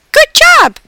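very high, loud little voice; squeaky hamster. A narrator's reading. Hamster praises the mother's English, high squeaky voice. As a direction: cheer it in a tiny squeak.

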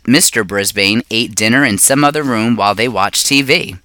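usual speaking voice

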